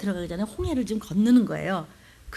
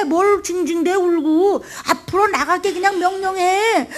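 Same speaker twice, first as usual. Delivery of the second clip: impatient scolding; 7 semitones higher, 5 dB louder than usual. Pretend. God scolding Moses.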